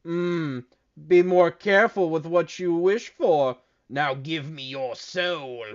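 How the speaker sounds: put-on villain genie, slowing at the end; hammed-up demonic wish-granter; sinister djinn. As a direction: menace them as a movie djinn, drawing out the last words. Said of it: "And he goes".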